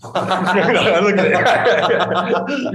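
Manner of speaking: laughing, high and loud. Real, not acted. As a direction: laugh through the words. Laughs at the mixed race question.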